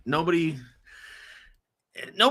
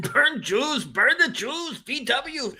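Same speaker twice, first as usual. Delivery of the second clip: higher-pitched put-on German accent; mock German accent; 7 semitones higher, slower than usual. Co-host redoes the German accent on request, hammed up. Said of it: "Do that German accent again".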